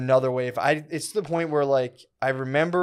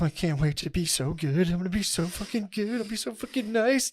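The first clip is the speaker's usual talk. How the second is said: giddy eager vow; 6 semitones higher than usual. Retold or imitated. Lying in bed on mushrooms, vowing to be good: high giddy gush. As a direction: gush it out like a kid swearing to behave.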